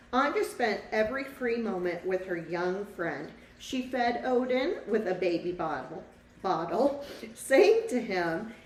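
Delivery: lively storybook read-aloud for a class; reading to children. A narrator's reading. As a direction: tell the story to the children, bright and clear. Teacher reads picture book aloud.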